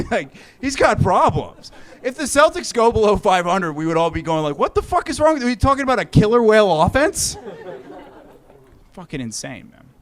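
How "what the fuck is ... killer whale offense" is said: high, loud incredulous yell; exasperated yell. Retold or imitated. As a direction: shout at the coach like a fed-up fan. Fans yelling at the losing coach.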